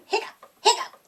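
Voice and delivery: high hiccups with a laugh; bouncy giggly hiccups. Acted. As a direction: hiccup through a giggle while hopping. Hiccups while hopping.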